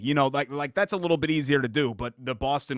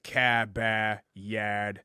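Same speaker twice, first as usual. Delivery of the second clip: Boston hard A; 2 semitones lower, much slower than usual. Shown as a demonstration. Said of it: "it's the hard A".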